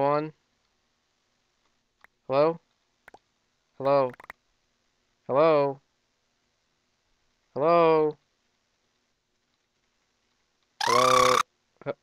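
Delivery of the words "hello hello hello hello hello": calling out. Real, not acted. Calling out to silent person.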